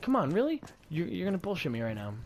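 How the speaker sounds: high, exasperated disbelief; retold incredulity. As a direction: challenge him as if unbelieving. Retelling his incredulous comeback.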